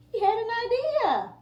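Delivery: storyteller's excited idea moment; excited reveal. A narrator's reading. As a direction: lift it up like a big surprise.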